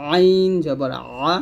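আইন জবর আ drawn out slowly, squeezed in mid-throat; slow, pressed throat sound on আইন and আ; pressed throat ayin. Shown as a demonstration. Teacher repeating the letter for learners, pressed ayin. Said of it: "চাপ দিয়ে বলবো".

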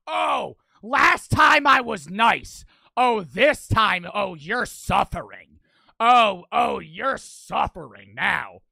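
loud, slow, drawn-out taunting; vengeful taunt. Real, not acted. Streamer promising suffering to game character, vengeful taunting. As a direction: stretch each phrase out loud, gloating.

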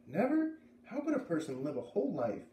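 very high rising disbelief on Never; disbelieving, her voice soaring on Never; incredulous. A narrator's reading. Narrator can't imagine it.

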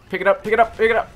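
high, loud, rapid urging; frantic urging. Real, not acted. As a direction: yell at the teammate to hurry.

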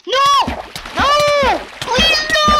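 very high, very loud screams; real anguished screaming. Real, not acted. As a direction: scream in horror as it all slips away. Loses suddenly in the game.

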